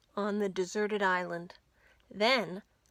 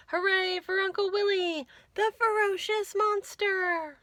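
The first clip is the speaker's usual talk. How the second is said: cheer; 9 semitones higher, 4 dB louder than usual. A narrator's reading. Cheering the hero.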